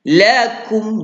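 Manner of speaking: ordinary delivery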